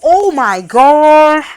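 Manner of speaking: long, drawn-out loud outburst; loud exclamation. Real, not acted. Speaker struck by the Biden link, loud exclamation. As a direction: blurt it out loud and stretch it.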